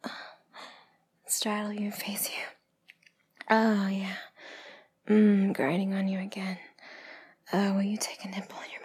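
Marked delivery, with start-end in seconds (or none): none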